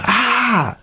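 high-pitched mock scream; imitated scream. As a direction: mimic her scream, not at full volume. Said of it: "I heard her scream".

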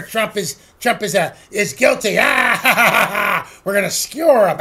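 inner pirate. Acted. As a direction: gloat like a pirate with a loud laugh. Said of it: "I'm channeling my inner pirate".

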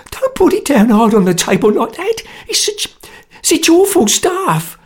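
high, loud, squeaky alarm; terrified squeak. A narrator's reading. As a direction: warn them in a panicky squeal. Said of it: "he squeaked under his breath".

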